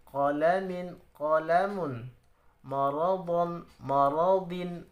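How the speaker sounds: each word said short and crisp, no stretching; quick short reading. Shown as a demonstration. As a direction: read the drill briskly. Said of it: "bacanya harus cepat dan pendek".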